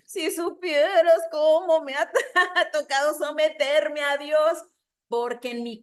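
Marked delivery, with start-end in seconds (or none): 0.1-4.6 higher, louder, slow, laughing through it; a loud, slow retort broken by a laugh; laughing reply. Retold or imitated. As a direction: burst out laughing mid-sentence. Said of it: "Yo dije".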